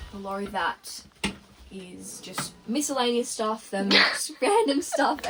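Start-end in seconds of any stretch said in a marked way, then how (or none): 3.7-5.3 higher, louder, slowed by a laugh. Real, not acted. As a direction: break into a laugh mid-phrase. Laughs at own jumbled storage.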